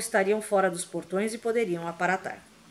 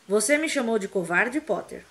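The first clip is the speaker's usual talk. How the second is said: plain reading voice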